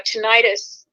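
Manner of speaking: "tinnitus" with stressed middle syllable rhyming with "night"; long-i pronunciation. Shown as a demonstration. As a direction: say "tinnitus" as tuh-NIGH-tus. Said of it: "I've always pronounced it tinnitus".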